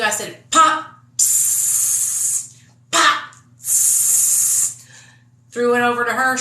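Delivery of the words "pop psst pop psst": unvoiced hissing pops and psst; tire stabbing noises. Slashing the truck tires, hissed pop noises. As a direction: mimic a knife popping tires, hissed. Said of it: "When I tell you".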